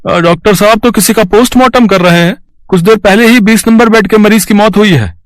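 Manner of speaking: very high-pitched put-on character voice; hospital helper. Helper Ramdin telling the nurse about a death, high character voice.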